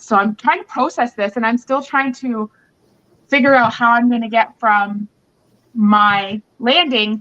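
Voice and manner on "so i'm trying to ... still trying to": ordinary talk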